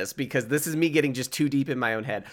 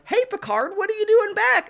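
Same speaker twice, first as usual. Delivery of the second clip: put-on much higher, squeaky little kid voice; kid Elnor; 4 dB louder than usual. Podcaster imitating child Elnor greeting Picard, very high kid voice. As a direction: pipe it up like a small boy greeting Picard. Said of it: "as a kid, he's just like".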